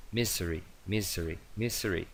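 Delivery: "misery" with a buzzed Z between vowels, soft; S sonora. Shown as a demonstration. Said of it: "Es una S entre dos vocales". ("misery" con ese sonora entre vocales)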